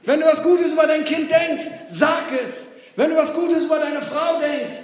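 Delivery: loud, high preaching appeal; shouted appeal. Real, not acted. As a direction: call it out to the congregation. Prediger ruft Gemeinde auf. (laut und hoch gepredigter Appell)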